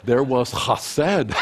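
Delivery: slow, loud joyful outburst breaking into a laugh; joyful exclaim. Real, not acted. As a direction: let the joy burst out. Joyful climax of the grandpa story.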